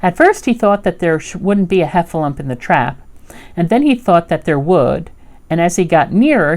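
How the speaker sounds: ordinary delivery, nothing marked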